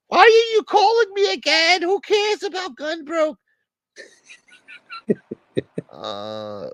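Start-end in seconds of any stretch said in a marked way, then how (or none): 0.1-3.4 very high, squeaky put-on voice. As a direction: answer in a shrill cartoon squeak. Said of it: "They're talking about you again".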